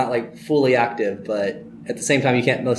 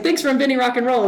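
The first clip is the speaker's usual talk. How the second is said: much higher gushing fan; starstruck thanks; 12 semitones higher than usual. Retold or imitated. Fan thanking [name], high and gushing. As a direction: gush it up high.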